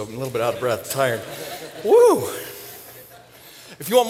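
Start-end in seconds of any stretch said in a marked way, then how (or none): 1.8-2.3 loud, high "woo"; energizing whoop. Real, not acted. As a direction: whoop to shake off tiredness. Shaking off tiredness on stage.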